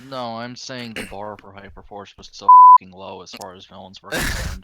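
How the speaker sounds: shouting about how bad it was; exasperated loud outburst; loud rant. Real, not acted. Ranting about Hyperforce villains.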